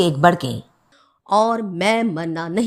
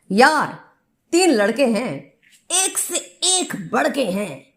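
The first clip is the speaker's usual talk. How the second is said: comic poem recitation; 7 semitones higher than usual. Acted. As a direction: perform it like a humorous stage poet. (हास्य कविता का नाटकीय, लयदार पाठ)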